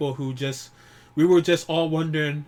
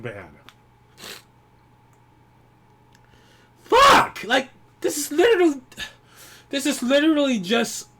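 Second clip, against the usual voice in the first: high, loud cries of grief and anger; real furious grief, near tears; anguished shouting; 8 semitones higher, 7 dB louder than usual. Real, not acted. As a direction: yell at the friend who left you. Grieving fan confronts dead streamer.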